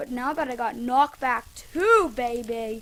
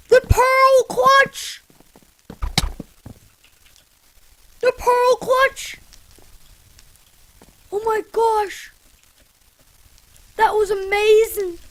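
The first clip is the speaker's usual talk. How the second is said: slow, high, loud triumphant cry; drawn-out excited shout; 9 semitones higher, 10 dB louder, slower than usual. Real, not acted. Gamer savouring his first pearl clutch, slow high shouts. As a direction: savour the clutch, stretch every word.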